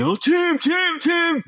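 high, loud imitation of a boy calling. Boy hailing youth worker down the street, calling out. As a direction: holler the name as if hailing someone from behind. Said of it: "this voice calling".

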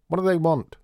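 high, loud call from afar. A narrator's reading. Old mother shouting from her armchair, high and loud. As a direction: demand it from the next room. Mother calling from back room.

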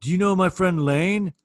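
slow imitating of a rocker asking about his friend; drawn-out, eager name-drop; lightly voiced former band member; rocker name-dropping. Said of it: "he's like".

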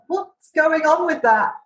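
high, disbelieving rhetorical question; exasperated disbelief; incredulous. Retold or imitated. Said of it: "And you're like".

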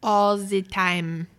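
slow, mock German accent. Host tosses off a line in a joking German accent. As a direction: drag the words out in a put-on German accent. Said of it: "Why did you say that in a German accent?".

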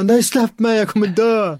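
high-pitched mock bawling; hammed-up cry of someone sure of doom; retold wailing. Teller acts out struggling free after smoking, mock wailing. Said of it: "Jag bara grinar".

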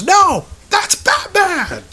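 put-on high, drawn-out kid's yell; excited movie kid. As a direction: shout it like an awestruck boy. Voices kid from the film.

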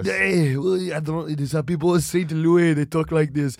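thick put-on Austrian accent, explaining; mock Austrian accent. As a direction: defend yourself in a heavy Austrian accent. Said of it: "why do you talk like that".

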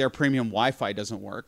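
ordinary delivery

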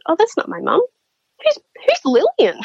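high-pitched mock bewilderment; imitating a puzzled woman; retold puzzlement. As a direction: voice her baffled reply, pitch climbing high. Retelling Mary's puzzled reaction to name.

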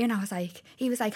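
ordinary talk

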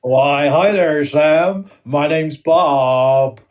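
slow, loud hammed-up American accent. Greeting Sam as host Bob, hammed-up American accent. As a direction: drawl it like a cheesy American host. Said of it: "we're doing accents again".

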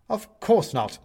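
high, loud denial; indignant protest. Acted. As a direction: reject the idea outright. Benedict protests at once.

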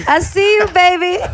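high, loud flirty croon; sexy voice. Pretend. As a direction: tease him with a flirty call. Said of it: "sexy voice".

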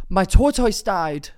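gasp, then high, loud, drawn-out announcement; slow dramatic wail of bad news; anguished outburst. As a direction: blurt out terrible news melodramatically. Sudden outburst about dead tortoise.

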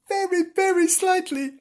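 very, very slightly in a tiny high voice; tiny squeak. A narrator's reading. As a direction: make it small and squeaky. Narrator shrinks the tail wag.

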